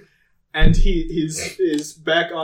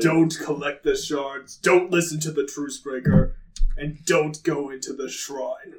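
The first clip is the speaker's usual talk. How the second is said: dark lord; 4 semitones lower, 4 dB quieter than usual. Acted. God lays down commands.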